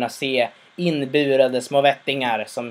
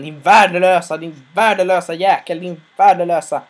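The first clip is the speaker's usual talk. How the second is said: louder, biting repeated insult; angry insult; 4 semitones higher, 7 dB louder than usual. Retold or imitated. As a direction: spit out the contempt at the son. Father insults his son.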